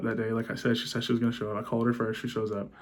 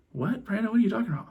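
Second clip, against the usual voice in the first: imitated wife, pitched way up; high, incredulous "what are you talking about"; baffled wife; 10 semitones higher, faster than usual. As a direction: act out her bewildered protest in a high voice. Voices wife's baffled denial in quarrel.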